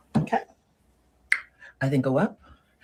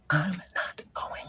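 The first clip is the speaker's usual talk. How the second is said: slow whispered refusal; refusing to go, whispered slowly; firm whisper; 3 semitones higher, 7 dB quieter, much slower than usual. Acted. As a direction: hiss it slowly and firmly.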